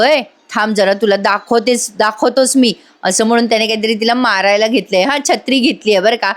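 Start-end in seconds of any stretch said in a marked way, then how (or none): none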